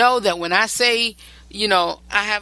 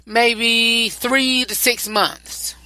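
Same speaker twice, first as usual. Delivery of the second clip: slow emphatic; 4 semitones higher, much slower than usual. Real, not acted.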